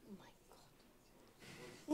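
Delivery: exasperated whisper. Real, not acted. On stage while the demo stalls, slow whispered despair. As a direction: breathe it out under your breath, drawn out.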